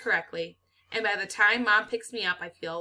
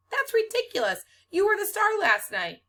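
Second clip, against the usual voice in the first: indignant; 13 semitones higher, 4 dB louder than usual. A narrator's reading.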